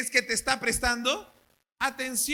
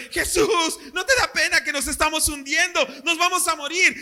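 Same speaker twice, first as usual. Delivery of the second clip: shouted higher and louder, full of alarm; frightened disciples yelling to Jesus over the storm; panicked shout; 5 semitones higher, 6 dB louder than usual. Retold or imitated. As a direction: yell it like men sinking in a storm. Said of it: "estaban gritándole".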